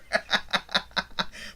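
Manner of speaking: airy unvoiced chuckle; a soft breathless laugh; breathy laugh. Real, not acted. Admitting he took his time, soft airy laugh. Laughing about slow drive.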